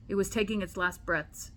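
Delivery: ordinary talk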